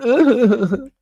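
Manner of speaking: sudden high burst of laughter. Real, not acted. Reviewer laughs at the crying bunny, high laugh. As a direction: crack up at the image.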